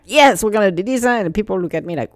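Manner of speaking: usual speaking voice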